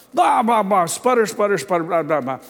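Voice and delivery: high, loud mock blah-blah sputter; imitating the neighbor's furious sputtering. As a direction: mimic an angry man's sputtering. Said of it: "he was still sputtering".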